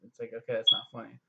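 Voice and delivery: normal talking voice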